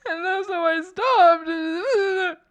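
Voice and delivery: slow, high, breaking into laughter; laughing while talking. Real, not acted. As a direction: laugh through the words. Laughing while telling story.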